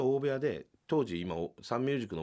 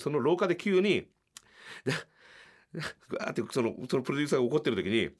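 usual speaking voice

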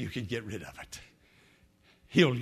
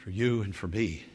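prayer; 6 dB quieter than usual. Acted. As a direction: pray softly over music. Leads a prayer.

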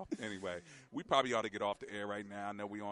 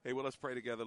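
normal talking voice

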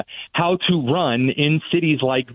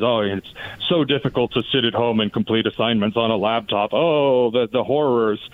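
sarcastic; 5 semitones lower than usual. Retold or imitated. Mocking school excuses for online work.

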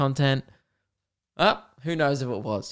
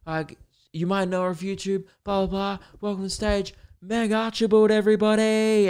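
rock star announcing; 6 semitones higher than usual. Retold or imitated. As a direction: hype up the crowd and introduce her. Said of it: "And he's like".

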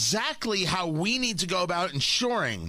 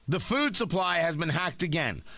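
ordinary talk, nothing marked

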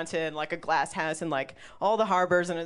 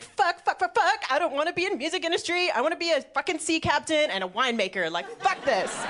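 high-pitched frantic outburst, re-enacted; retold crisis panic; 8 semitones higher than usual. Comedian re-enacting a quarter life crisis, panicked. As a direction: rant in a raised pitch, hammed up. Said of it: "my quarter life crisis".

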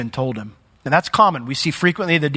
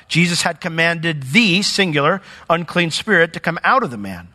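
usual speaking voice

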